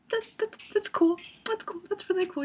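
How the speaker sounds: high, squeaky attempt at playing cool; starstruck stammer. Fan trying to act cool before their photographer, starstruck. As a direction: stumble over it in a high, overexcited voice.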